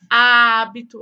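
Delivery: hábito with a slow, loud, open first A. Shown as a demonstration. Teacher stressing the open A in hábito. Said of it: "ó o meu A mais aberto".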